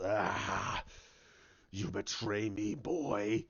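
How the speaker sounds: deep, slow, half-whispered villain rasp; Snoke's voice. Retold or imitated. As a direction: loom over him, low and menacing, breathing the words. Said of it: "He goes".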